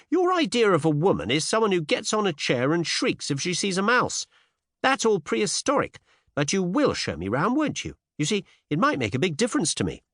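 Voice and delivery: put-on high, insistent young woman; persuasive Cinderella. A narrator's reading. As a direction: press him eagerly in a high voice. Cinderella argues, then coaxes.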